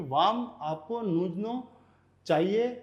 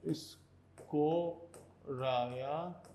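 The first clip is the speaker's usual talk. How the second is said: स्कोरा या very slow and softer, is, ko, raa-yaa; syllable by syllable; 3 semitones lower, 7 dB quieter, much slower than usual. Shown as a demonstration. Teacher writes out the word for ambulance, स्कोरा या in slow soft syllables. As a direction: dictate स्कोरा या quietly, each syllable stretched. Spelling out word while writing.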